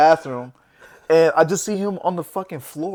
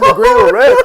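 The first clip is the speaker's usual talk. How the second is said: laughing; 8 dB louder than usual. Real, not acted. Recalling colour confusion, laughing.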